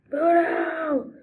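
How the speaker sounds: real exasperated yell; frustrated yell. Real, not acted. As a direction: shout it out in frustration. Yells at game character.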